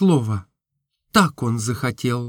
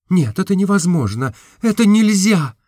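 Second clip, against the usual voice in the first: resolute; 5 semitones higher than usual. A narrator's reading. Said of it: "отвечала она решительно".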